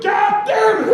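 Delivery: very high, loud holler; exasperated shout. Acted. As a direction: explode as the outraged shopper. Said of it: "And he goes".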